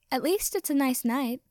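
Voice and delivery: high, lilting consolation; singsong. A narrator's reading. Tiny fairy looking on the bright side, high singsong. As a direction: lift it into a cheery singsong.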